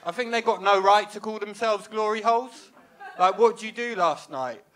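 normal talking voice

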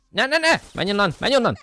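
high, loud frantic pleading; panicked yelling. Real, not acted. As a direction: shout it in a panic. Panicking as car gets hit. (pánikos kiabálás)

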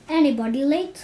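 teacher checking who is late; roll call. Acted. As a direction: call out to the class.

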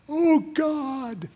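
high, pleading cry to God; a touch of desperate begging; a high plea of "Oh, God". Shown as a demonstration. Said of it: "It means strong crying, begging".

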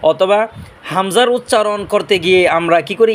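normal talking voice

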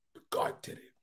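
hushed whisper of awe. Real, not acted. Preacher testifying to God's work, awed whisper. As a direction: breathe it in wonder.

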